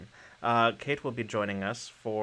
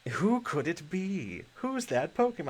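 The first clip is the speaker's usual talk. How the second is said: Pokemon announcer voice; 7 semitones higher than usual. Pretend. Mimics Pokemon show catchphrase.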